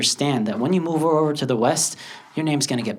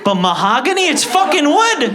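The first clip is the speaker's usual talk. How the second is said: indignant protest; 12 semitones higher, 6 dB louder than usual. Acted. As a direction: protest the absurd name, climbing higher and louder. Acted protest at mangled name.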